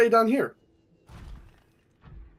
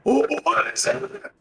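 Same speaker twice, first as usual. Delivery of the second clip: scared; 9 semitones higher than usual. Real, not acted. Hears something in scary game.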